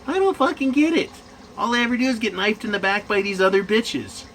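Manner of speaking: imitating frustrated women. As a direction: whine it like an exasperated woman online. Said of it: "a lot of women on mgtow channels going".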